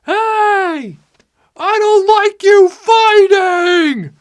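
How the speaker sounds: high, long-stretched holler from afar; mock passer-by bellowing across a valley; yelling over wind. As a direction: holler it slowly, stretching the words. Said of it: "gotta yell over the volume of the wind".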